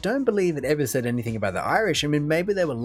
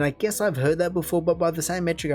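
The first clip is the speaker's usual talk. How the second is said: usual speaking voice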